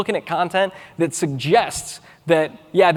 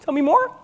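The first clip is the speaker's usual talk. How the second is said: "Tell me more" with the pitch rising high on "more", like a question; upward inflection; 9 semitones higher than usual. Shown as a demonstration. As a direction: lift "more" up at the end to invite an answer. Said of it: "in that upward inflection".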